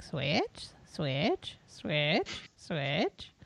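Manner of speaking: each Switch a low swoop rising sharply; imitated eager crowd. Host imitating everybody asking for Switch, repeated rising question. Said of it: "everybody was like".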